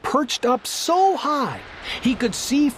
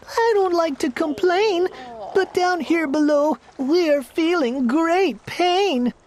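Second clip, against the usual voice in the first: lightly voiced strained little turtle, higher; groaning plea; 6 semitones higher than usual. Mac pleads with the king from the bottom, mild groan. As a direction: beg from under the weight, mild groan. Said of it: "groaned Mac".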